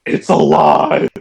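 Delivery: slow words broken by laughter; laughing. Retold or imitated. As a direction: laugh through a mock protest. Laughing while quoting himself.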